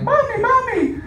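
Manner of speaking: put-on high squeaky little kid calling; child voice. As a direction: pitch way up like a small child. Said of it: "I heard this one say".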